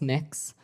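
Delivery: Mix said as one short syllable, rhyming with six; pronunciation of Mix. Shown as a demonstration. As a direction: say Mix slowly, to rhyme with six. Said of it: "It's pronounced".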